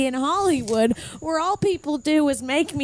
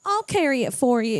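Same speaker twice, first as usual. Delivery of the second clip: ordinary talk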